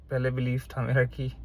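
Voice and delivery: normal talking voice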